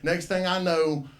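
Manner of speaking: ordinary talk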